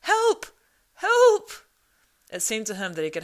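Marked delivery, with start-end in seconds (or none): 0.0-1.5 very high, loud calls for help; shrill cries for help. Acted. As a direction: yell into the wind, desperate. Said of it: "ran along the path crying".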